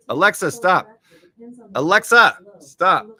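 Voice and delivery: high, loud command to cut her off; yelling at Alexa. Real, not acted. Host to a blaring smart speaker, real yell. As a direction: yell over a speaker at full volume. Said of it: "you have to yell at a ten".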